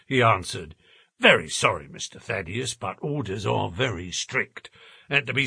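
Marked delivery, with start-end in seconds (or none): 1.2-5.5 apologetic porter. Acted. Porter now apologises after relenting.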